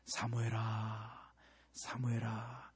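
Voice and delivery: soft, low, slow call; gentle calling. Acted. God calling Samuel tenderly, soft and slow. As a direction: call the name slowly and softly. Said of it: "사무엘의 이름을 부르잖아요".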